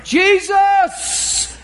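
a high call to Jesus. Acted. Blind beggar calling out to Jesus, pleading.

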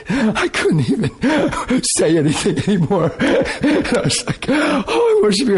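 laughing, voice going high; giggling through the story. Real, not acted. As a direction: break into laughter mid-sentence. Laughing while recounting pain.

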